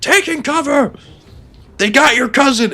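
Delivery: high mock English soldier yell; funny soldier line. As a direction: shout it like a panicked squaddie. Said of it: "the lines are so funny".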